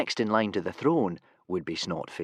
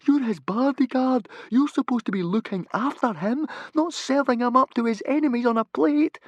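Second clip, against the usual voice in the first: put-on high, louder scolding boy; indignant Fishlegs; 5 dB louder than usual. Fishlegs scolding Humongous for volunteering Hiccup. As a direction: scold the useless bodyguard.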